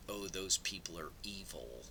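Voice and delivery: whispered mock quote; hushed scoffing whisper. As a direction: breathe it like a naive believer. Mocking a naive reading.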